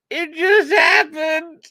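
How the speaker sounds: very high, loud, slow mock wail; drawn-out comic high voice; squeaky exaggerated excuse.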